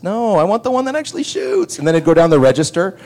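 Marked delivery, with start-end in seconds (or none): 0.0-1.8 put-on high whining child voice; whiny kid. Kid whining to mom for the shooting toy. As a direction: whine like a kid wanting the real toy.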